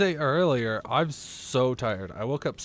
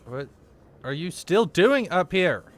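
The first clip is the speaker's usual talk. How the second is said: exasperated yell; 6 semitones higher, 5 dB louder than usual. Real, not acted. Leviathan returns.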